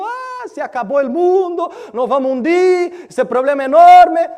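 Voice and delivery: high, loud, desperate cry that the world is ending; frantic disciple crying out in the storm; panicked wail. Acted. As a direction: wail it like you're sinking. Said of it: "Nosotros estamos muriendo".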